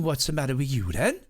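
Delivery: put-on a light Welsh lilt for a small boy; Welsh child accent. Welsh nephew questions the injured narrator, lightly Welsh-accented child voice. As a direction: ask it like a curious Welsh four-year-old. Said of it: "He was Welsh".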